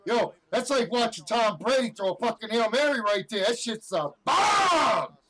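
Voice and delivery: high, loud hyped shouting; stoked sports-fan yell; pumped up. Real, not acted. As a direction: roar it like a game-winning throw. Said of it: "That was me pumped up in a good mood".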